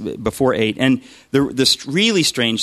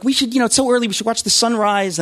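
much higher, bright mock-perky voice; chipper woman; 11 semitones higher than usual. Housemate at dawn urging a beach trip, mock-chipper and much higher. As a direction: imitate an overly chipper early riser. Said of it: "she was so chipper".

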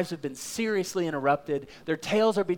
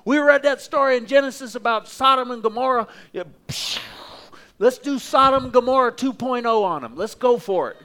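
higher and louder, itching for a fight; eager disciples; 8 semitones higher, 5 dB louder than usual. Acted. Disciples begging Jesus to destroy the village, hammed-up eagerness. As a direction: egg Jesus on to let you loose. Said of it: "they said, Jesus".

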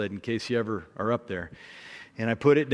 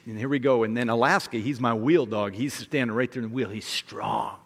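plain reading voice

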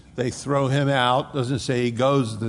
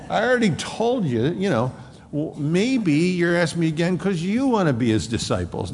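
higher, mocking and exasperated, talking back to the Pharisees; sarcastic retort; 6 semitones higher than usual. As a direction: needle them with cheeky sarcasm. Man healed of blindness mocks Pharisees.

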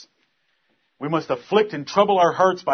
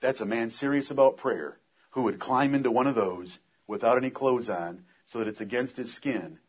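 low, soft, earnest preaching; quiet sermon; 4 semitones lower, 4 dB quieter than usual. Acted. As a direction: draw them in softly. Preacher quiet intimate opening.

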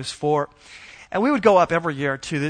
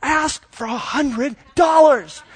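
put-on much higher, slow kid voice with a giggle; little girl again; 9 semitones higher, 5 dB louder, much slower than usual. Dad imitating his four-year-old pushing her big sister, high and giggly. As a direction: plead it like a tiny insistent child. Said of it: "So she's like, back at, a second time".

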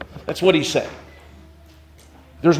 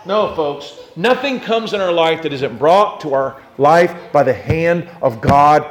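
ordinary delivery

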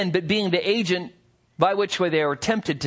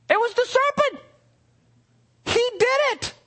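much higher, squeaky mock Eve voice; mock Eve excuse. Preacher mimicking Eve blaming the serpent, squeaky. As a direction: squeak out the excuse like a caught child. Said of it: "Turns to the woman".

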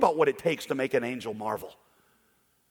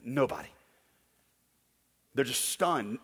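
ordinary talk, nothing marked

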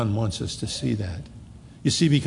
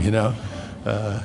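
ordinary talk, nothing marked

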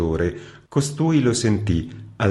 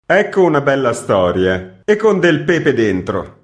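loud, higher ironic exclamation; exclaiming; 6 semitones higher, 6 dB louder than usual. A narrator's reading. Administrator after Gvozdev leaves, loud wry exclamation. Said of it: "esclamò l'amministratore".

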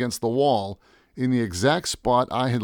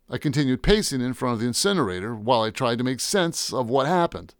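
plain reading voice

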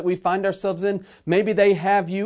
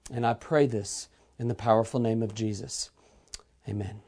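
lowered voice, softening toward the amen; prayer; 4 semitones lower than usual. Real, not acted. Preacher praying to the Father for those listening, reverent prayer.

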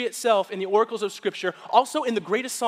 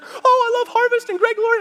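much higher and louder gushing nurse voice; 12 dB louder than usual. Retold or imitated. Pastor imitating the nurse gushing about church, high and loud. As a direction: gush like an overexcited fan.

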